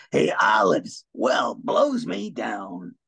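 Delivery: slow, gruff sailor shout; Popeye voice. Retold or imitated. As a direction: bellow like a cartoon sailor. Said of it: "Or Popeye".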